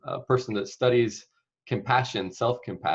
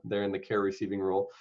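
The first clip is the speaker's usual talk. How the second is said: ordinary delivery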